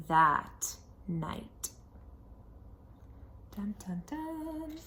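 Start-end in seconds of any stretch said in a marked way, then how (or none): none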